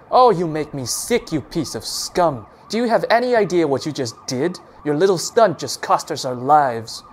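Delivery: loud, contemptuous scolding; disgusted. A narrator's reading. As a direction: spit out your disgust. Officer berates Doc.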